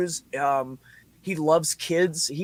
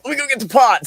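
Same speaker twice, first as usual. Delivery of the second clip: higher, louder excited call; eager call; 10 semitones higher, 8 dB louder, faster than usual. Retold or imitated. John offering to fetch the pot, excited higher call. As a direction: jump at it with glee. John's eager reply retold.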